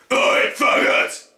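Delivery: a slow, low, rasping yell with no pitch; low growled yell. Shown as a demonstration. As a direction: growl it out from the gut. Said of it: "try it with lowering your voice".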